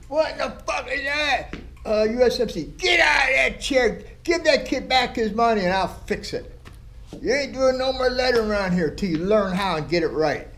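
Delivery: put-on an old tattooer shouting down a sloppy apprentice; retold mentor's yell. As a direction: bark him out of the chair. Mentor yelling at apprentice's mistake.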